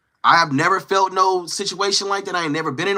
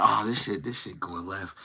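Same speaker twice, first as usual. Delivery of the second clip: low, very soft aside; hushed re-enacted reaction, pitched low; muttered aside; 9 semitones lower, 10 dB quieter than usual. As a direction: drop right down as if commenting to yourself. Voices an aside while watching trouble.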